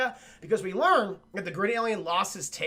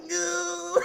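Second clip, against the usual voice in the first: high, drawn-out "no"; mock wail; 9 semitones higher than usual. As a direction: stretch it out in a high voice. Predator's retold drawn-out no.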